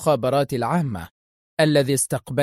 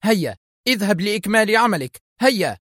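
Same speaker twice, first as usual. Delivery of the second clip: urgent order, pitched up; calling out; 6 semitones higher than usual. A narrator's reading. Boss hurries programmer out to work, exclaiming. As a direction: send him off with a restrained cry. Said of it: "صائحة".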